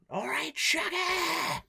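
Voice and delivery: high, drawn-out mock scream; retold phone scream. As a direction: screech it as a greeting down the phone, stretching it. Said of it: "I screamed down the phone".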